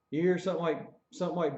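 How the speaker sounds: usual speaking voice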